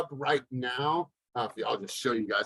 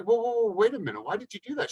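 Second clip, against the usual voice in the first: higher-pitched retold whoa, whoa, whoa of disbelief; mock alarm at the cashier, pitched up; surprised protest; 9 semitones higher, faster than usual.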